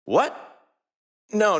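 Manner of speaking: ordinary delivery, nothing marked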